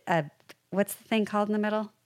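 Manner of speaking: ordinary talk, nothing marked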